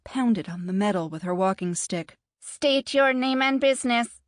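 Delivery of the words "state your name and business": loud, higher machine voice; mechanical gate voice. A narrator's reading. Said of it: "its mechanical voice harsh and grating".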